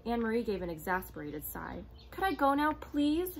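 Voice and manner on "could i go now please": high, loud impatient plea; girl urges the soldier, much higher and louder. A narrator's reading. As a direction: push to be let go. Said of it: "she asked impatiently".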